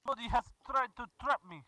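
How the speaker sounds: hammed-up Russian accent, broken English; mock Russian accent, gamer joking; fake Russian accent. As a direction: play a comic Russian comrade in a Russian accent. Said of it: "tired of doing the voice".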